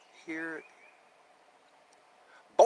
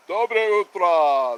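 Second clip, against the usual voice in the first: loud, very slow Russian good morning; Russian greeting; 9 dB louder, much slower than usual. Shown as a demonstration. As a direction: stretch the Russian greeting out loudly. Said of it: "That's how they say it in Russian".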